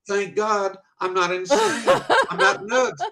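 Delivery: high, loud, laughing relief; excited relief. Retold or imitated. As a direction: burst out with giddy relief. Relief that it's real.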